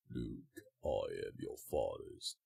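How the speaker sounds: deep, slow movie-villain line; low and drawn out, softly weighty. Shown as a demonstration. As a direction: drop to the bottom of your range. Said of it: "If I really want to go for lower pitch".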